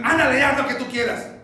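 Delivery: loud, high, fast giving-in; exasperated surrender. Acted. Father giving in to nagging child, exasperated. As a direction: throw it out fast and fed up.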